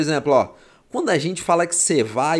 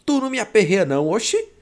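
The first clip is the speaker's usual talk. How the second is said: put-on high, lilting Northeastern Brazilian accent; Pernambuco accent; 6 semitones higher than usual. Teacher imitating someone from Pernambuco, high Northeastern accent. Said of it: "Você vai falar assim".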